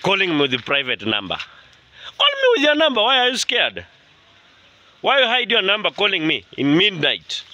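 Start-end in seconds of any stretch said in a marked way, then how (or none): 1.4-7.4 high-pitched challenge to an anonymous caller; indignant scolding. Real, not acted.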